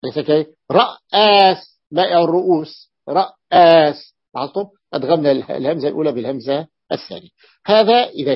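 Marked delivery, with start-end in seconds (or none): 3.1-4.2 رأس said very slowly with a lengthened, doubled hamza; drawn-out doubled hamza. Shown as a demonstration. Teacher showing students the doubled hamza in رأس, very slow. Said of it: "تشديد، يعني همزة".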